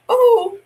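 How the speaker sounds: high, loud shocked "oh"; startled "oh". Real, not acted. Player spots a character they hate, high startled cry. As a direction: yelp "oh" in sudden recognition.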